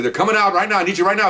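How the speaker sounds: urgent radio call. Retold or imitated.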